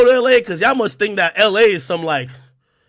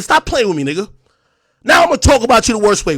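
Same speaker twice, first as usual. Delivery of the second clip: ordinary talk, nothing marked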